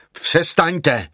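high, urgent cry. A narrator's reading. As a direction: cry out to cut someone off. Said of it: "vykřikl jsem na ní".

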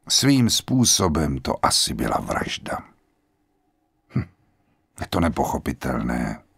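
lightly voiced grave general; old general, calm and grave. A narrator's reading. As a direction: speak like a resigned old man at peace.

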